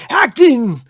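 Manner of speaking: hammy, projected stage actor; big drawn-out theatrical delivery; thespian voice. Pretend. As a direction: declaim it loudly like a thespian. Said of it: "the thespian's voice".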